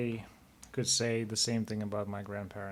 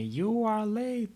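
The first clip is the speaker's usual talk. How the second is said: high, drawn-out mock yell of a scolding grandmother; imitating a yell; 12 semitones higher, slower than usual. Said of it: "she would yell at me".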